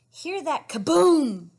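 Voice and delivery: slow, loud read-aloud; big storybook boom for the kids; reading to children. Acted. Read-aloud picture book.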